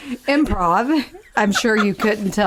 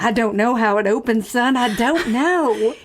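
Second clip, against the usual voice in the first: put-on Southern belle accent. Improvised witness on the opening door, Southern accent. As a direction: play a baffled Southern witness. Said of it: "our Southern Belle accents".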